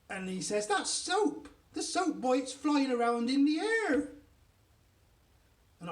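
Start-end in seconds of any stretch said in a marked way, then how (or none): 0.7-4.1 high, loud astonishment rising to the end; amazed exclamation. A narrator's reading. Daddy seeing soap float in the air, astonished. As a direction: exclaim in mounting disbelief.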